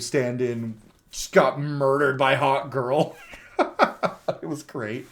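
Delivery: slow, breaking into laughter; real amusement. Real, not acted. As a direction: relish it until the laugh takes over. Delighted retelling, breaks into laughing.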